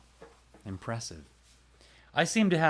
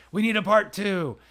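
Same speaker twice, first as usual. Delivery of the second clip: high, loud mock clamour; eager demand; 11 semitones higher, 10 dB louder than usual. Listeners demanding a sequel from the makers, eager loud demand. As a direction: imitate fans clamouring loudly for more.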